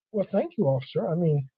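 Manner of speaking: soft, breathy grateful thanks; relieved thanks. Retold or imitated. Relieved thanks after gasp.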